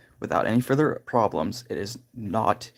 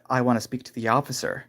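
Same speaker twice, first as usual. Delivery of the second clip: ordinary delivery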